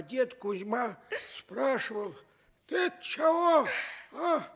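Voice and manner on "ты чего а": put-on a puzzled old grandpa calling out; the grandfather asking what the noise is. Old grandfather voiced in story.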